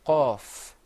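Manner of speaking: qaf said full-mouthed from the throat, lips pursed. Shown as a demonstration. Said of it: "pronounced full-mouthed. You kind of purse your lips".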